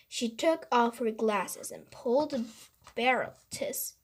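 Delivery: plain reading voice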